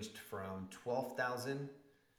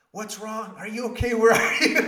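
very high, loud mock-frantic mother; panicked mom; 14 semitones higher, 21 dB louder than usual. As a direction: shriek it like a terrified mother, laughing. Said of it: "that was basically the conversation".

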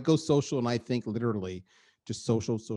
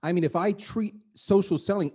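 normal talking voice

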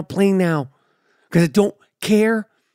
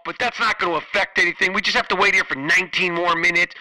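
cabin PA voice, softer than own talk; flight attendant announcement; 4 dB quieter than usual. Acted. Flight attendant breaking bad news to passengers, imitated PA announcement. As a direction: announce delays over the plane intercom. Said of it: "the flight attendants have to come on there".

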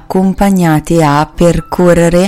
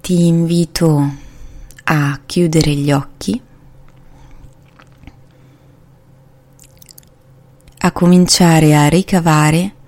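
unhurried, calming meditation voice; guided meditation. Acted. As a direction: ease the listener into relaxation, slowly. Leads a guided body scan.

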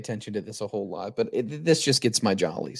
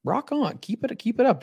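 excited cheering; 9 semitones higher than usual. Real, not acted. Cheering friend's weight loss.

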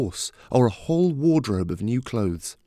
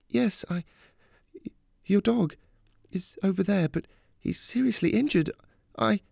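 put-on high, halting young heroine's voice; hesitant bearer of bad news; 11 semitones higher than usual. A narrator's reading. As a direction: break it gently, pausing and faltering. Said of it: "She tried to continue".

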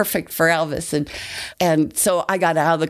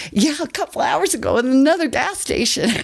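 eager reply; 10 semitones higher than usual. Retold or imitated. Girl answers [name] eagerly.

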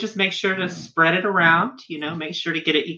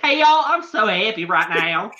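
higher-pitched, thick Southern twang; excited Southern accent; 4 semitones higher than usual. Shown as a demonstration. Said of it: "it just slips right on out".

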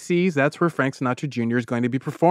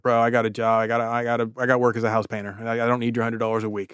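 low, softer brush-off; unimpressed refusal; 5 semitones lower, 5 dB quieter, faster than usual. Retold or imitated. House painter declining Barry's trip north, low mock-reluctant refusal. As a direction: turn him down quietly, imitating a reluctant bro. John turns Barry down, retold.

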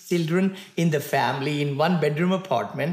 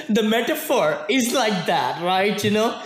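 talking through the tail of a laugh; 3 semitones higher than usual. Real, not acted. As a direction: let the laugh spill into the words. Still laughing after his saying.

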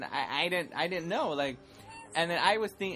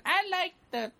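high mock fan voice; imitating a fan; 4 dB louder than usual. Host imitating fans' comments, high mock voice. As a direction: put on a high-pitched commenter voice. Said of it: "They leave us comments saying".

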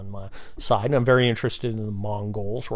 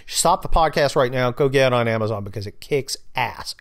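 ordinary delivery

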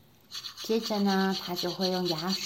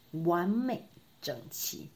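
usual speaking voice

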